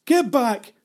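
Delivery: high, loud command; angry shout. Acted. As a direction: shout to drive him away. Jenny pushes Belfield away.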